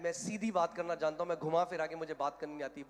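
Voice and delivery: ordinary talk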